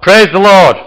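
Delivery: loud, high, drawn-out shout. Acted. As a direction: shout it out as a rider's command.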